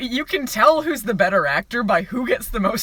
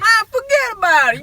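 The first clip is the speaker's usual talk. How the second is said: high, loud mock Italian-American wiseguy; Italian cliche voice; 11 semitones higher, 6 dB louder than usual. Pastor preaching to Kevin Sorbo, mock Italian wiseguy. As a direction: toss off a hammed-up Italian mobster line. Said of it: "He says all of the Italian cliches".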